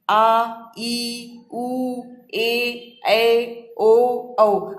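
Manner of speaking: आ, ई, उ, ए, ऐ, ओ, औ held long and loud; long vowels drawn out. Shown as a demonstration. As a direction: stretch each long vowel. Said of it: "दीर्घ स्वर कौन कौन से हैं?". (दीर्घ स्वरों को लंबा खींचकर बोलना)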